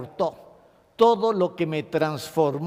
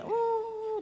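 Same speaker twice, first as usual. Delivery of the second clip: mock whimper; 6 dB quieter than usual. Shown as a demonstration. Mock crying after cinema.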